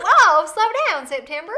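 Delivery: high, loud, slow hillbilly holler; hillbilly accent. Pretend. Pretending to halt September for friends, mock hillbilly accent. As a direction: holler it in a hammed-up hillbilly drawl. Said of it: "I'm so good at the hillbilly".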